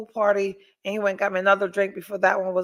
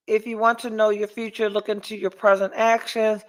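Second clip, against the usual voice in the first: ordinary delivery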